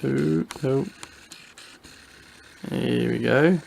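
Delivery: normal talking voice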